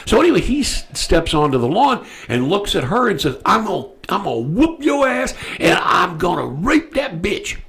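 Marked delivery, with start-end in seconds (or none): 3.4-7.6 imitated menace, pitched high; high mock threatening voice; retold threat. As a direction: act out the attacker's threat in a raised pitch.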